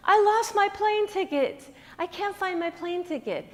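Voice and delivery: high, slow, tearful wail; crying in tears. Acted. Stranded backpacker at the airport, crying high and slow. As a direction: sob it out like a distraught traveler. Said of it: "she burst in between tears".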